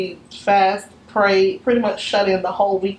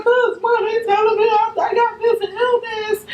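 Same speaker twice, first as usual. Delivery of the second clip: much higher, strained crying voice; retold crying to mom; 13 semitones higher than usual. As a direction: sound like you are crying to your mom, pitched way up. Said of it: "I was like".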